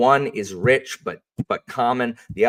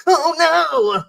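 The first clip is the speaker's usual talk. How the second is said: much higher, louder squealing cry of fear; panicked villain; 9 dB louder than usual. Acted. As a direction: squeal like a cornered coward. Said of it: "crab walking back on the floor, being like".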